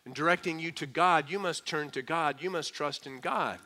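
ordinary talk

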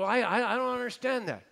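high, loud, baffled protest; baffled listener. Retold or imitated. Baffled congregant protesting to the pastor, high and loud. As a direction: squeal it like a confused churchgoer. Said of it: "You go".

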